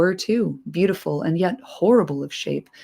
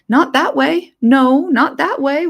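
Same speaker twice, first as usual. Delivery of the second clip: high, loud, desperate creature cry; Gollum; 9 semitones higher, 7 dB louder, slower than usual. Acted. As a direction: shriek it like a terrified Gollum. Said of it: "whispered Gollum".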